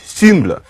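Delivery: "symbol" with a French-like u vowel, lips rounded; "symbol" as Old English sumbl; front rounded vowel. Shown as a demonstration. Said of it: "the cute loon sound in French".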